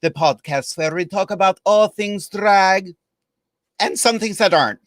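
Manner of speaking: hammed-up vampire countess accent with welcome said as velcome; a camp Transylvanian countess purring hello to the kids; playful vampire accent from a drag hostess. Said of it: "I can't do the accent all night".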